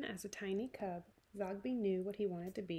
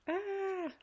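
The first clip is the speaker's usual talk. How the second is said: high, loud scream; startled scream; 12 semitones higher, 4 dB louder than usual. Acted. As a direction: cut off by a shriek of fright. Customer screams.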